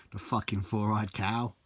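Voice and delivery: ordinary talk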